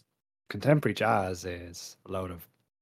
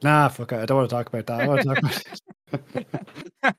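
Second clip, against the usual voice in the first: mock dismissive guest; 3 semitones higher, 5 dB louder than usual. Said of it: "and you'd be like".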